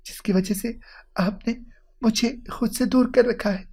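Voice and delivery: high, halting, emotional plea; distressed wife, voice quivering; trembling voice. A narrator's reading. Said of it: "کپ کپاتی آواز میں بولی".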